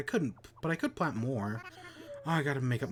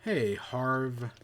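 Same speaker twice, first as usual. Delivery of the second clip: normal talking voice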